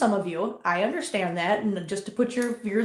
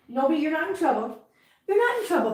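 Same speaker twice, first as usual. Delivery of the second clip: high cooing reassurance; soothing pet talk; 6 semitones higher than usual. Real, not acted. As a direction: coo to a cat that all is well. Soothing a cat.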